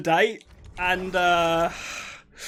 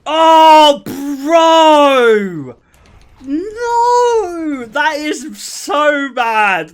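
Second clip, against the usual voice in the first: loud, high, drawn-out shouts of disbelief; appalled outcry; 7 semitones higher, 10 dB louder, much slower than usual. Real, not acted. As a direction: cry out in horror at an awful confession. Appalled reaction to a read-out message.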